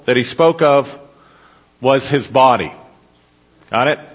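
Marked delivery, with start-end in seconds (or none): none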